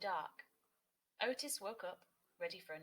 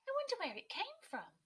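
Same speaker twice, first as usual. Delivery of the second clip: wondering aloud, pitched way up; curious wondering; 4 dB louder than usual. A narrator's reading. Kingfisher wondering about the feather, high pitched. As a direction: puzzle over it in a high voice. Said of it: "she thought".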